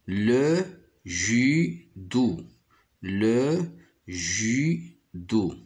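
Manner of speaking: le judo drawn out very slowly; slow syllables. Shown as a demonstration. Teacher to pupils, saying judo very slowly. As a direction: stretch out le judo so the syllables are clear. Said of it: "Le mot judo contient deux syllabes".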